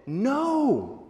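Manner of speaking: an emphatic, full-voiced no; full-throated no. Shown as a demonstration. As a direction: throw your whole voice into the no. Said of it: "Full-throated".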